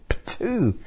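sharp spitting sound. Retold or imitated. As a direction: spit out the sour bite. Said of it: "I go".

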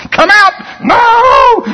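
high, loud commanding yell; a loud screamed back-and-forth; shouting. Retold or imitated. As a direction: yell the devil out. Deliverance shouting match.

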